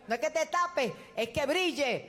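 repeated preaching refrain swelling to a climax; preaching at full force. Acted.

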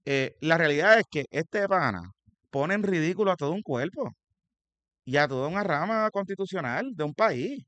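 denuncia encendida, en voz más alta y aguda; indignado. Real, not acted. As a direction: suelta una denuncia pública con indignación real. Indignada denuncia del senador electo. (indignado, alzando la voz)

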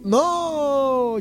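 long, high 'No' sliding slowly down; emphatic drawn-out no. Acted. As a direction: stretch the No out in refusal. Emphatic rejection of the imagined gossip.

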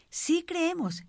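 crowd's conviction, pitched high without shouting. Retold or imitated. Onlookers answer the man about crossing back, high convinced cry. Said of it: "gritaban más convencidos todavía".

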